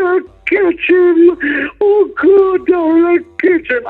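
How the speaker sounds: imitating a wailing caller. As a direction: wail it like the hysterical wife, very slow. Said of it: "in tears that the woman is crying".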